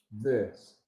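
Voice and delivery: "this" with the tongue tip tapping the upper teeth; "this" softly, tongue tapping behind the upper teeth, not sticking out; tapped voiced th. Shown as a demonstration. Teacher showing how to tap the teeth on "this". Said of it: "like this".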